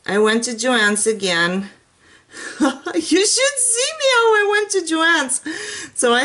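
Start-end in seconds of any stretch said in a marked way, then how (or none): 2.9-5.4 very high, laughing as she talks; laughing through words. Real, not acted. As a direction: giggle out the line at yourself. Laughing at her own shopping trip.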